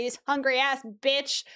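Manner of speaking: usual speaking voice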